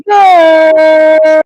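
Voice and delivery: big shouted yeah; roaring crowd hype; loud cheer. Real, not acted. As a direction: hype the crowd at full volume. Host hypes crowd.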